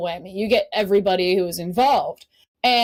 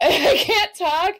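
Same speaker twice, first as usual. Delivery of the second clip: slow, high, mock-choked words; imitating tearful choking; 8 semitones higher, slower than usual. Meeting her idol and tearing up, mock tearful. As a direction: squeeze it out as if welling up. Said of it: "tears start forming in my eyes".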